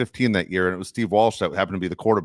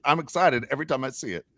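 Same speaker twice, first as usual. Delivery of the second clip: ordinary delivery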